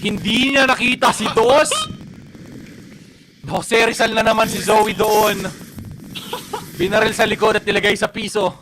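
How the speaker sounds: loud hyped play-by-play; excited play call. Real, not acted. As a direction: call the kill with full hype. Caster calls a sudden kill.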